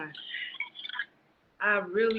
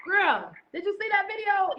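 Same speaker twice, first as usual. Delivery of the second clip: excited exclamation; 10 semitones higher than usual. Real, not acted. Excited question to the host.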